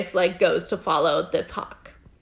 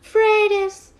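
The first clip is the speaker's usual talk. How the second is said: high, slow pleading cry, higher voice; pleading scream; 11 semitones higher, slower than usual. Retold or imitated. Woman in a burned village begging Freydis not to leave, high pleading cry. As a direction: cry out her name, begging her to stay. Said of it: "a woman is screaming".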